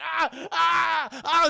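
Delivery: repeated high mock yelps; imitated cries of distress. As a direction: cry out as if in pain. Imitating cries as back bubbles.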